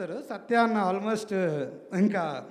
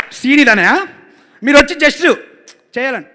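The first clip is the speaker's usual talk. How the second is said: loud, higher, pleading to the star; eager loud pitch; 6 semitones higher, 16 dB louder than usual. Retold or imitated. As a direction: push the scene on big brother excitedly. Director voiced pitching scene.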